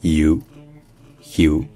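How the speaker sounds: "you" avec un y devant ou; OU anglais. Shown as a demonstration. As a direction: glisser du y au ou dans "you". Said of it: "le O U se dit you". (« you » à l'anglaise)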